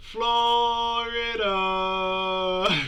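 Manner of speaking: slow, high, stretched shout; mock scream. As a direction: belt out the name. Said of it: "I'm at work screaming".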